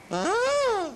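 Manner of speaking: high honking goose cry. A narrator's reading. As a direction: honk like a goose. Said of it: "she honked".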